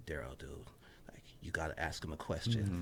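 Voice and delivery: low, hushed self-urging, near a whisper; soft, low inner voice; talking to himself. Retold or imitated. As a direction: murmur a private pep talk to yourself. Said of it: "saying to myself".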